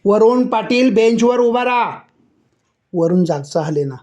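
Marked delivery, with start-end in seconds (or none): 0.1-2.0 high, raised voice barking an order; shouted order. A narrator's reading. Teacher orders stubborn pupil, high shout. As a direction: command him onto the bench. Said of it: "sir पुन्हा ओरडले".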